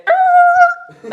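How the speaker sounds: very high, loud tire screech; car screech noise. Retold or imitated. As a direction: imitate brakes slamming with a piercing squeal. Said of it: "the car said".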